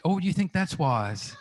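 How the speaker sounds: gently doubtful mother, half-laughing; imitating mum. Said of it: "she said".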